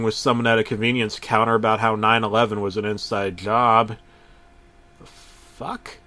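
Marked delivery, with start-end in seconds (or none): 5.0-6.0 hushed, baffled whisper; whispered aside. Acted. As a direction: breathe it out in disbelief. Narrator's shocked aside.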